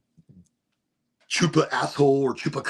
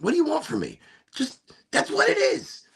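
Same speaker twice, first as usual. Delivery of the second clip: exasperated. Real, not acted. Defensive helicopter parent rant.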